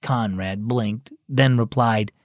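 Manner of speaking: plain reading voice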